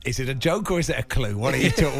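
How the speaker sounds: ordinary delivery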